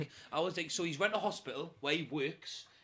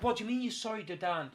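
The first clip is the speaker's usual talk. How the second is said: retold indignant demand; 6 semitones higher than usual. Retold confrontation over apology.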